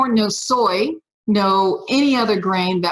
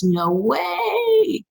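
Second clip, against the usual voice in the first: slow, high, laughing no way; rueful laugh, very slow and high; laughing disbelief; 10 semitones higher than usual. Retold or imitated. Despairing about walking an hour.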